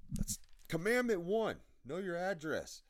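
loud, high, drawn-out announcement; mock proclamation. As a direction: belt it out like a commandment from above. Proclaims his first commandment.

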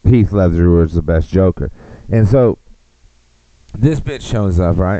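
ordinary talk

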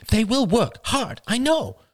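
mother quickly defending her sister; rapid talk. A narrator's reading. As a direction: rattle it off eagerly. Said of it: "said Marie rapidly".

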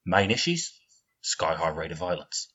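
low, quiet Michael Caine voice; Michael Caine impression. Friends' impression game, quiet low Michael Caine voice. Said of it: "That was Michael Caine".

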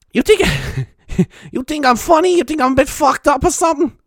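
hammed-up threatening New Yorker; Judge Judy impression. Judge Judy impression goading the audience, hammed up. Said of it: "bad impression of Judge Judy".

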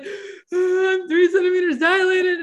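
high, slow tearful words through crying; bawling. Real, not acted. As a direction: choke it out while crying on the phone. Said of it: "I'm, like, bawling".

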